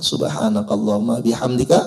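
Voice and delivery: Arabic prayer intoned low; closing prayer. Acted. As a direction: recite the closing prayer reverently.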